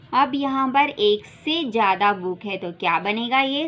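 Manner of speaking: ordinary talk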